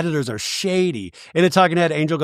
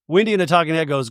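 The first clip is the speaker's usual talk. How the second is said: usual speaking voice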